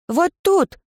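put-on high excited little girl; eerie ghost child. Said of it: "Призрак девочки указал".

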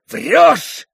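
high, loud accusing shout; angry cry. A narrator's reading. Mitya calling Fenya a liar, angry cry. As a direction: throw the accusation out sharply. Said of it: "вскричал Митя".

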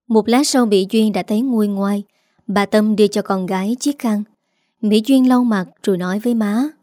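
plain reading voice

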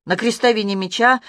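usual speaking voice

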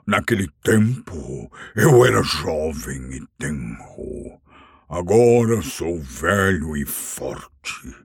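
put-on a proud old dragon gloating; boastful dragon. Smaug boasts of his strength. (dragão velho e orgulhoso)

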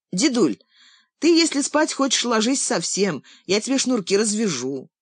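put-on high, eager child offering help; a grandson sweetly offering to untie laces; boy's voice. Boy talks to grandpa.

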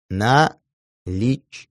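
«Наличными» drawn out slowly, in hushed awe; slow awed repeat. A narrator's reading. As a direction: stretch «Наличными» slowly, stunned by the sum. (медленно и потрясённо: «Наличными»)

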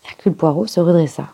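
usual speaking voice

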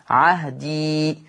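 عهدي drawn out very slowly, final ya kept; pause form. Shown as a demonstration. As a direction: hold the ya of عهدي long at the stop. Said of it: "أثبت الياء". (عهدي ببطء شديد مع إثبات الياء)

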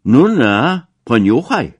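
taunting the passengers with a shout; loud call. A narrator's reading. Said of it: "zawołał". (szyderczy, głośny okrzyk)

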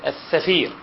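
"الثفير" with th in place of s; lisping th for s. Shown as a demonstration. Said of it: "يقول السين ثاء".

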